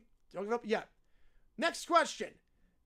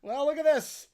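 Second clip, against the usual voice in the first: ordinary talk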